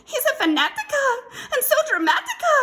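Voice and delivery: very high, loud, rapid squeal; dramatic little chihuahua. A narrator's reading. Poquito Tito describing the Babalito, squealing dramatically. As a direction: rattle it off in a squeaky frenzy.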